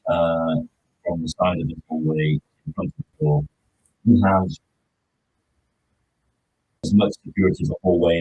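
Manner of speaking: muffled as if through a hand. Real, not acted. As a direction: talk with a hand over the mouth. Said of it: "you're muffled again".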